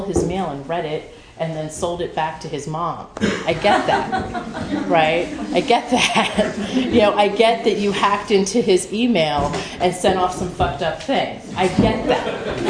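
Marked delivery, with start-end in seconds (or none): 3.5-12.6 laughing. Real, not acted. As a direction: chuckle through each I get that. Laughing at her own past.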